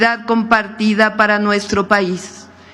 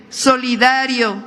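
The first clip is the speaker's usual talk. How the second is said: ordinary talk, nothing marked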